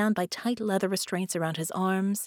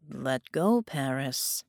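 bland, dull tenor; 4 semitones lower, slower than usual. Acted. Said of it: "in a bland, dull-sounding tenor".

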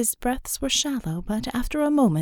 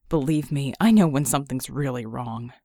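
lower, dry teasing man's voice; wounded wry man; 6 semitones lower than usual. A narrator's reading. Red ribbing Bella after their kiss, dry and low. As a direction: tease her through the pain. Red's voice.

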